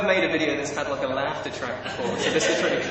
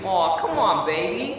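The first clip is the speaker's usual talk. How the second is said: American accent. Shown as a demonstration. Brit trying American phrases, American accent. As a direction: plead it in a put-on American voice. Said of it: "my best American accent".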